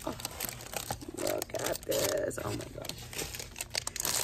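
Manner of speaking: slow, barely voiced whisper. Real, not acted. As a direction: breathe it out slowly in awe.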